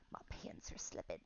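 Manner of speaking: a soft unvoiced whisper under her breath; whispered aside. Real, not acted. Instructor mid-exercise noticing her pants, a whispered aside. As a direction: murmur it to yourself so the class barely hears.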